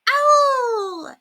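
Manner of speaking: high, loud puzzled squawk; confused parrot. A narrator's reading. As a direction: squawk in bewilderment. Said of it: "Now the bird sounded confused".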